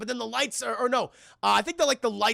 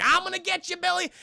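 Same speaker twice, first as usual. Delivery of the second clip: little creature voice; 9 semitones higher than usual. Retold or imitated. Streamer as Gizmo driving off to help Billy, high creature voice. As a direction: pitch it up as a tiny hero.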